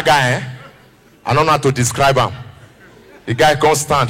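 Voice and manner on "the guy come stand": ordinary talk, nothing marked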